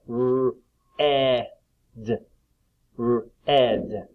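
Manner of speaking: "R-e-d" with each sound held separately; sounds split apart. Shown as a demonstration. Teacher splitting red for pupils, sound by sound. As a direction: sound out "R-ed" slowly with gaps. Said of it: "as we split the sounds apart".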